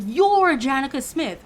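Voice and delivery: a hint of a star-struck worker repeating; excited insisting. Worker pointing in her face, mildly excited.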